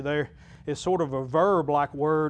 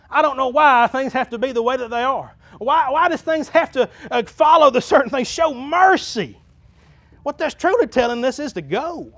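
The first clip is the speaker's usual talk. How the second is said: raised, high preacher's voice; impassioned preaching; 9 semitones higher, 10 dB louder than usual. Real, not acted. Pastor urging church to show mercy, impassioned preaching. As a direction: press it on the congregation, loud and high.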